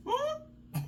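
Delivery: high, soft feigned confusion; playing dumb. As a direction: pretend not to understand. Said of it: "act like he spoke a whole nother language".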